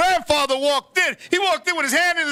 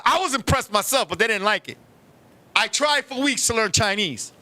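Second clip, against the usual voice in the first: normal talking voice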